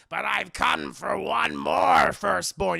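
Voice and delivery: slow, loud, raspy villain voice; hammed-up raspy villain proclamation; Danny DeVito's Penguin. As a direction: rasp it out slowly and menacingly. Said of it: "Danny DeVito's penguin's like".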